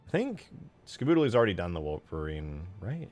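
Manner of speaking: usual speaking voice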